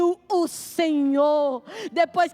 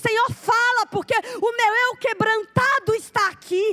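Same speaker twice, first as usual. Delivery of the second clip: prayer climbing higher and stronger line by line, then slowing right down; fervent prayer that builds, then slows to a plea; building prayer; 5 semitones higher than usual. Acted.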